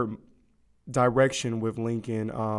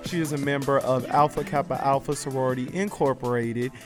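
upbeat announcer voice, higher and louder; radio host intro; 4 semitones higher, 4 dB louder than usual. Acted. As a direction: present the guest like a radio host. Host introduces guest on air.

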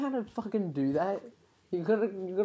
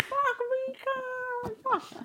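excited quote; faster than usual. Retold or imitated. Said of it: "I was like".

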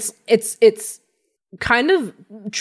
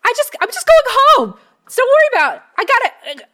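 small creature, nervous and defensive; high, quick little gnome voice, x1.5; 4 dB louder, much faster than usual. Acted.

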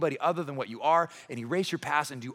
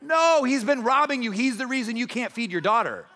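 raised, high-pitched protest; acted outrage, loud at the start; indignant objection; 6 semitones higher, 4 dB louder than usual. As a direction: fire back on behalf of the wronged crowd. Voices the crowd's anger at Zacchaeus.

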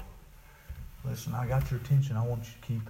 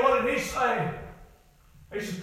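ordinary talk, nothing marked

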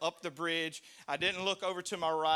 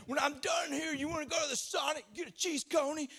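mock shout; 10 semitones higher than usual. Fighter invites speaker to Sonic mid-fistfight, high casual shout. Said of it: "he's like".

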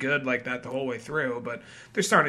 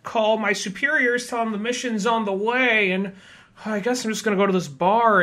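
mock whiny cop; 7 semitones higher than usual. Host voicing the stranded cop complaining, high whine. Retelling movie character.

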